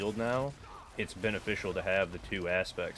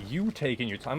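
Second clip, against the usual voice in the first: mocking; 5 semitones higher, 4 dB louder than usual. Mocking the messager's words.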